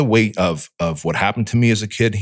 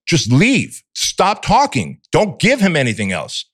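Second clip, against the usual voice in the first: higher, half-performed yell at himself, slowing on stop talking; yelling at myself; 7 semitones higher, slower than usual. Retold or imitated. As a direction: order yourself out in a mild mock yell. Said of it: "I'm yelling at myself".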